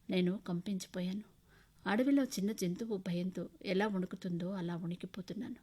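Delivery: ordinary delivery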